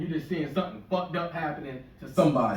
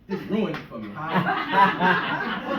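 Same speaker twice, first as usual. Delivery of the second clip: normal talking voice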